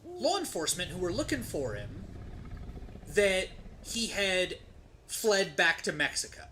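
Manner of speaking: ordinary talk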